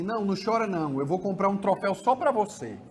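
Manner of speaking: put-on father bribing a crying kid with a trophy; indulgent father. Shown as a demonstration. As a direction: coax the child with easy promises. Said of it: "Alguns pais fazem assim".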